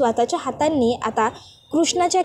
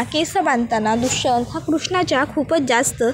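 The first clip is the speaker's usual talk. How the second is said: usual speaking voice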